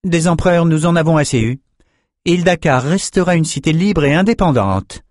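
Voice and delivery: high-pitched, measured woman ruler; high, authoritative council voice; haughty ruling sorceress. A narrator's reading. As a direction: speak as a high-voiced, cautious sovereign.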